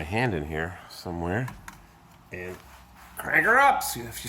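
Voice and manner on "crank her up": excited call. Real, not acted. Starting car after two years.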